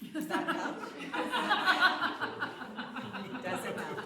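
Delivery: high, loud mock question; sarcastic. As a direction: scoff at the idea it helps, laughing. Mocking the dismissive reply.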